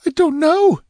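very high, loud terrified cry; stammering in terror. A narrator's reading. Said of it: "she stammered".

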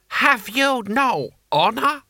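high, drawn-out indignant cry; outraged scream. A narrator's reading. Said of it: "screamed Lord Hong".

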